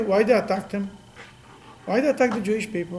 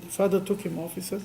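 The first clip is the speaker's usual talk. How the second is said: ordinary talk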